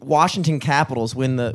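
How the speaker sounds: usual speaking voice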